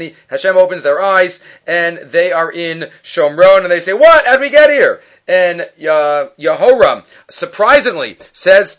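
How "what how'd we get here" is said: high, loud mock astonishment; startled disbelief. Blinded army suddenly seeing where they are, acted-out shock. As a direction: act out a shocked double take, pitched up.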